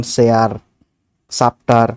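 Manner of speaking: সেয়ার with an s sound in place of ch; wrong s for ch. Shown as a demonstration. Teacher showing the common Bangladeshi mistake, s for ch. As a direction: say সেয়ার starting with a plain s. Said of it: "চ এর বদলে আমরা স উচ্চারণ করে ফেলি".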